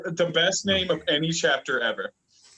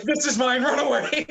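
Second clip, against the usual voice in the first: higher, louder greedy squeal of a claim; hammed-up Power voice; 11 semitones higher, 3 dB louder than usual. Retelling the chapter, Power voiced claiming Chainsaw Man, hammed-up and high. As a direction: play the gleeful thief snatching her prize.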